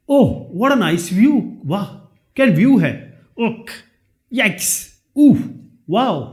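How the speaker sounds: a string of mock exclamations, lightly surprised; sample interjections. Shown as a demonstration. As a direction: act out each little outburst as an example. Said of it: "जैसे". (हल्के बनावटी विस्मयादिबोधक)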